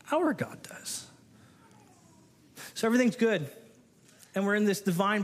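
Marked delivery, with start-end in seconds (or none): 0.1-1.1 soft awed whisper; hushed answer about God. Real, not acted. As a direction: whisper it like a secret. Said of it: "whispered answer".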